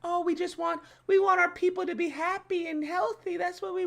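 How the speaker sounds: high-pitched simpering mimicry; mocking PC studio talk. Streamer mocks studio caring for staff, mocking high voice. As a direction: whine it in a silly high voice to ridicule them. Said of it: "All this little P C bullshit".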